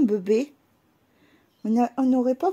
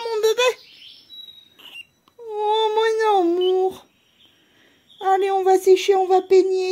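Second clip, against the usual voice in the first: higher sing-song cooing to a pet; 7 semitones higher than usual. Real, not acted. Owner soothing her pet after its bath, high cooing.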